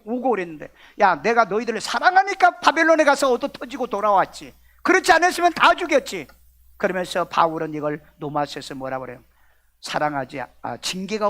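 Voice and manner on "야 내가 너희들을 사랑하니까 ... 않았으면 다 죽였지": higher, heated comeback rising at the end; God's scolding retort. Acted. God answering a complaining Israel, scolding. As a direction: scold them with mounting pitch and force.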